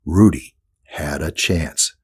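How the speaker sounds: usual speaking voice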